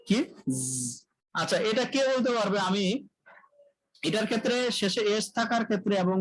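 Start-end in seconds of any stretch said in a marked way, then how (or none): none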